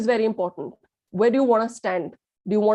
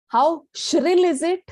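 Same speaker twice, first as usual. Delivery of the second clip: raised high, slowed and slightly louder; shrill; 7 semitones higher, 3 dB louder, slower than usual. Shown as a demonstration. Trainer demonstrating shrill pitch to the class, high. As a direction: lift the voice up high to show shrillness. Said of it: "Shrill, high, high notes".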